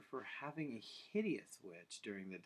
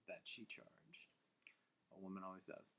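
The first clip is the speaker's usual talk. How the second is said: hushed; 5 semitones lower, 11 dB quieter than usual. A narrator's reading. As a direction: let the price hang in a whisper. Witch's price, ominous.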